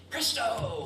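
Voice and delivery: high showy magic word; kids party magician. Acted. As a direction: proclaim it like a stage magician.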